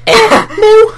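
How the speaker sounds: high scouse moo, said with a cry; a Liverpool cow mooing, hammed-up. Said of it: "what would a Liverpool cow sound like".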